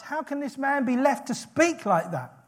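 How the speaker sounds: high-pitched, scandalised disbelief; shocked. Acted. David appalled that Goliath may speak so, shocked. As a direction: ask it in affronted shock. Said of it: "He's shocked".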